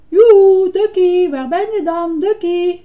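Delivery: high, loud searching call. Acted. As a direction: call out for a lost toy. Ernie calls out for lost Ducky. (hoog en luid roepen)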